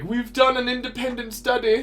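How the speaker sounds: high-pitched mock Russian accent. Imagined Russians reporting on America, high mock Russian accent. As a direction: put on a high, comic Russian voice. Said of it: "This is my Russian voice".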